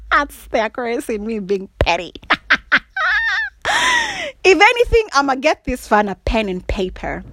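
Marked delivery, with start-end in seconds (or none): 2.2-4.4 a very high, squealing burst of laughter; shrieking laugh. Real, not acted. Big laugh.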